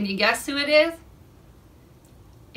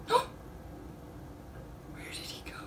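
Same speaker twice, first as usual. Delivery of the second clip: hushed wonder; 13 dB quieter, faster than usual. Pretend. Spider washed out, mock worry.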